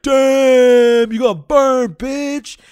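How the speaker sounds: high, loud hooting taunt; imitating the crowd's loud, high gloat; mock crowd taunt. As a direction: jeer it like a crowd at a roast. Crowd voiced taunting Sabrina.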